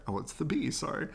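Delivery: plain reading voice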